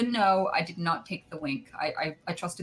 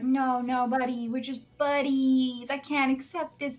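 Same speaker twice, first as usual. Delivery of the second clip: awkward refusal; 6 semitones higher, slower than usual. Retold or imitated. Awkwardly refusing the Valentine gift.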